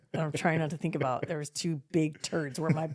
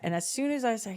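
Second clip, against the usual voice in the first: usual speaking voice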